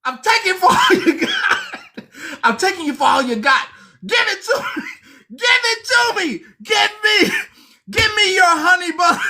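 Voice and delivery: very high shrieking mock shout; hammed-up demand for the honey bun; mock prison robber. Imagined inmate robbing a newcomer, a shrill mock shout. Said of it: "somebody just gonna grab you".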